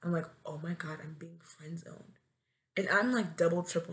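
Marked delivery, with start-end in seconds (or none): 0.4-2.2 dismayed whisper. Retold or imitated. Realizing their crush only wants friendship, dismayed whisper.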